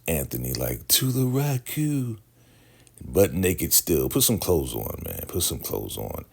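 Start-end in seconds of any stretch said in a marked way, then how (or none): 0.9-2.2 high, drawn-out call; mock rallying call. Anthony rallying everyone to the Raku, high mock call. Character voiced calling out.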